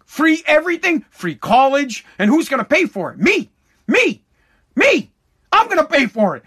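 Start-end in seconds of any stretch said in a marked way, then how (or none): 3.2-6.4 indignant cry. Real, not acted. Who pays for socialism.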